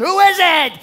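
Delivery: high, loud holler back; yelling. Retold or imitated. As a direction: imitate a woman shouting from inside the house. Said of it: "And she's yelling".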